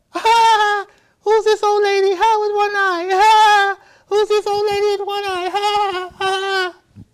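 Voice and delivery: put-on very high, loud jeering kid voice; children's mocking laughter. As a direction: squeal and cackle like taunting children. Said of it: "my children laughed at her".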